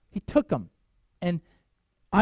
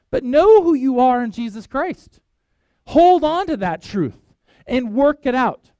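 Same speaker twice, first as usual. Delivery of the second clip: fervent exhortation; 5 semitones higher, 7 dB louder than usual. Real, not acted. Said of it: "preacher exhorting".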